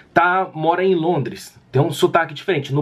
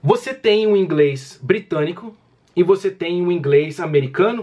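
normal talking voice

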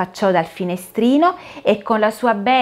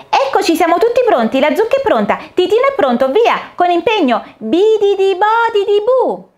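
high, louder fairy chanting the magic words; fairy casting spell; 12 semitones higher, 6 dB louder than usual. Acted.

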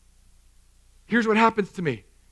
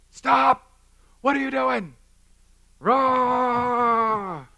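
lashing out; 6 semitones higher, much slower than usual. Retold or imitated. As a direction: snap at the bickering kids. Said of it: "so I lash out".

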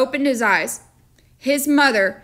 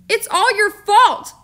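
put-on much higher, louder, slow accusing boy; 10 semitones higher, 6 dB louder, slower than usual. A narrator's reading. Boy accusing the storekeeper, higher, louder and slow. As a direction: drag out the blame like an angry child. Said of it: "John declared".

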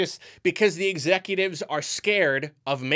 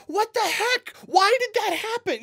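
much higher, incredulous; baffled outcry; 3 dB louder than usual. Retold or imitated. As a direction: squeal at the screen in disbelief. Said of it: "all of us were just like".

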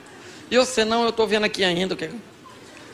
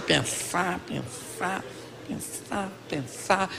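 soft, trailing repeated pondering; quietly acted-out deliberation; pensive repetition; 12 dB quieter, much slower than usual. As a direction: murmur it over and over, mulling endlessly. Acts out week of pondering.

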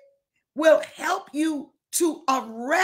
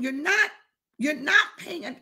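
ordinary delivery

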